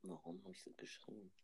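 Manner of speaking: hushed, breathy whisper; whisper yelling. Real, not acted. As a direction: drop to a sudden whisper. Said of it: "Flüstern wir jetzt?". (Flüstern)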